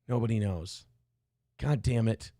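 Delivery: usual speaking voice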